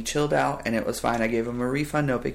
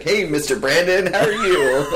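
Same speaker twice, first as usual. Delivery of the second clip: louder, higher, laughing greeting; overeager boss; 6 semitones higher, 7 dB louder than usual. Retold or imitated. Imitating his boss greeting him too eagerly, laughing. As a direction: overdo the friendly hello.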